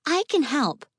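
put-on high, eager little girl; eager child voice. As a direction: insist like a keen kid. Child insisting.

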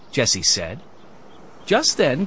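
usual speaking voice

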